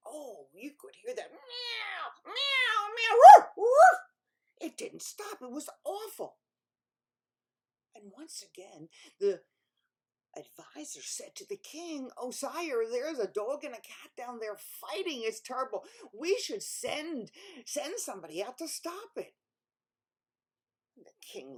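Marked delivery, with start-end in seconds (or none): 2.3-4.0 very loud high meows and woofs; a frantic animal brawl; fighting cat and dog. Acted. 12.2-19.4 1 urgent advisor. Acted. Advisor urges king.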